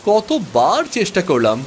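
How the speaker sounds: put-on high, restless young prince grumbling at the rain; a spoiled boy fishing in the rain and losing patience; boy's voice. (বৃষ্টিতে বিরক্ত ছোট রাজপুত্রের উঁচু গলা)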